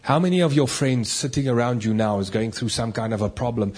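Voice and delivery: normal talking voice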